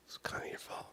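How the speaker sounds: sneaky little whisper; hushed blaming aside. Pretend. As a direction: whisper it like a secret accusation. Muttered blame at chat.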